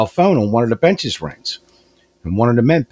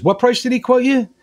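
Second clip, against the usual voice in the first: husband's voice jumps much higher in alarm; 13 semitones higher than usual. Retold or imitated. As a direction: squeak out the question like a startled husband. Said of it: "he goes".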